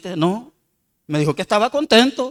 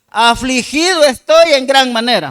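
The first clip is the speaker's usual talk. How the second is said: loud, raised-pitch verse reading; forceful Bible recitation; scripture proclamation; 5 semitones higher, 7 dB louder than usual. Acted. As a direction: declaim the psalm out to the congregation. Reads psalm verse aloud.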